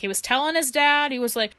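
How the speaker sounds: normal talking voice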